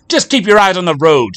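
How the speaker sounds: high, loud furious retort; the wife snapping at her driving husband; spat back. Acted. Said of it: "she spat back at him".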